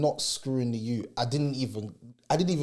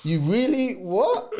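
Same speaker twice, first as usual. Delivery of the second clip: higher, louder retold disbelief with a laugh; confused disbelief; 7 semitones higher, 5 dB louder, much slower than usual. Man retells reacting to the driver's accusation, baffled laugh. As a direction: blurt it out baffled, half laughing. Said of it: "I'm mad confused".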